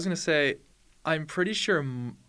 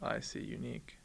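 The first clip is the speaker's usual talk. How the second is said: breathy whisper to himself; whispered aside; 9 semitones lower, 12 dB quieter than usual. Real, not acted. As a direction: mutter it under breath. Quiet aside.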